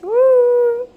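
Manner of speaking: loud high whoop; excited woo. Real, not acted. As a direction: cheer at the success. Call connects on watch.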